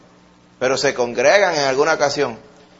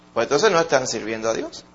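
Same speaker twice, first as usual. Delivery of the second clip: ordinary talk, nothing marked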